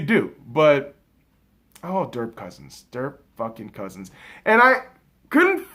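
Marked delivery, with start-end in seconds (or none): 1.8-4.1 1 quiet disgust. Real, not acted. Disgusted sigh at Cousins.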